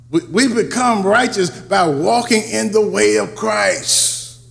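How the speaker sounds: rising preacher's delivery; preaching. Acted. Preacher builds again.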